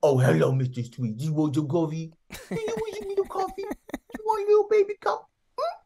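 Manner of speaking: put-on high cooing baby talk to an adult; very high sing-song baby voice. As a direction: talk down sweetly like to a toddler. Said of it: "they're like".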